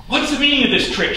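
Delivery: high, fast outcry; shouted demand. A narrator's reading. Uther to Merlin as the dragon roars in, shouted. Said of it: "shouted Uther into the roar".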